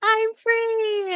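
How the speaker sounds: high, exultant cry; high, drawn-out mock jubilation; mock elation. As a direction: cry out in imitated triumph. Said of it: "where he's like".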